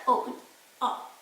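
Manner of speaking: "O" as a clean round vowel; a short pure "O"; plain O. Shown as a demonstration. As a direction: demo the bare vowel "O". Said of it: "like a normal O".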